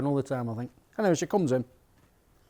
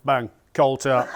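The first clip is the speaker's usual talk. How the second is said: ordinary talk, nothing marked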